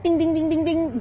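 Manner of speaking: high, loud ping-pong "bing" noises; rapid-fire high-pitched mimicked bouncing; imitated bouncing. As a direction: rattle off bings like a bouncing ball.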